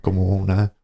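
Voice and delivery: normal talking voice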